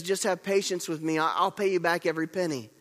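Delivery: ordinary talk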